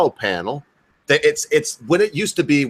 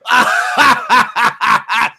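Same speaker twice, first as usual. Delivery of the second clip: loud, high mock guffaw; high staccato cackle, imitating someone cracking up; imitated uncle's laugh; 9 semitones higher, 6 dB louder than usual. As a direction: burst into a big imitated belly laugh. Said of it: "my uncle was like".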